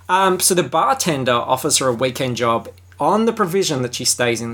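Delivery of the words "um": ordinary talk, nothing marked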